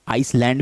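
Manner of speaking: आइसलैंड with sounded S, "ice-land"; wrong pronunciation. Shown as a demonstration. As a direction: voice the written S in आइसलैंड. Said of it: "लेकिन गलत है".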